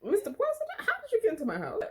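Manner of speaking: very high, squeaky disbelief; mock shock. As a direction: squeal it like a flustered, starstruck host. Acted shock at surprise intruder.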